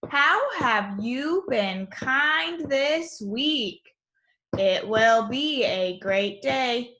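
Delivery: put-on slow, measured read-aloud for young children; reading to class. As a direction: read each word out deliberately for the class. Reads morning message to class.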